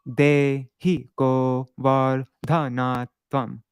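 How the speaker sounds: "Dehi govardhanatvam" recited slowly, each syllable spaced; metered recitation. Shown as a demonstration. Teacher showing the second half after the caesura, slow and measured. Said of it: "and then we pause".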